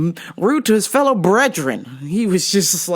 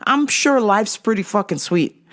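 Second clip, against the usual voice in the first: ordinary talk, nothing marked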